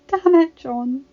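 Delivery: blurting it out through tears; tearful exclamation. Acted. Dany in tears, moved.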